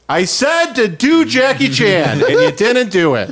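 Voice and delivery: high, slow, laughing complaint; mock indignant heckler. Heckler scolds the comic, high and laughing. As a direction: complain he skipped it. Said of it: "he's like".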